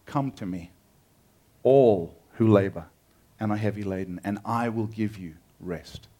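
soft, low and gentle; tender invitation. Acted. Jesus inviting the weary, soft and tender. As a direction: draw the weary in softly.

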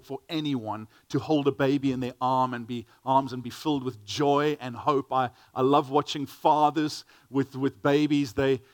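ordinary talk

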